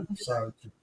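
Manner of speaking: low, soft, subdued sorry; composed apology. Retold or imitated. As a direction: imitate a calm, humble apology. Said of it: "He was composed".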